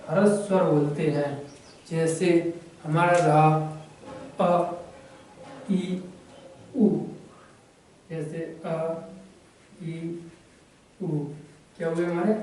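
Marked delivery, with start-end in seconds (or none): 4.4-6.9 short, quick vowels अ, ई, उ; अ, ई, उ as brief separate sounds. Shown as a demonstration. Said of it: "उच्चारण करते समय हमें कम समय लगता है". 8.7-11.1 soft, brief अ, ई, उ; short अ, ई, उ with pauses between; short vowels. Shown as a demonstration. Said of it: "ह्रस्व स्वर हुए".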